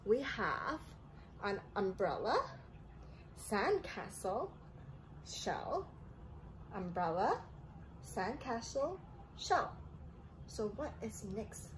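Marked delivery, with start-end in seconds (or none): none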